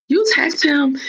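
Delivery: high-pitched incredulous question; retold disbelief. Girlfriend questions boyfriend about texting another man, disbelief. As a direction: retell it as a startled challenge.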